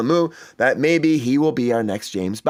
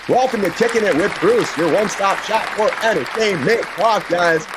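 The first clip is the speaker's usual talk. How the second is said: show intro; 5 semitones higher than usual. Acted.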